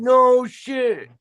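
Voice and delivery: high, loud amazed exclamation; real amazement at the deal. Real, not acted. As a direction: jump up in pitch in disbelief. Reacting to the cheap meal.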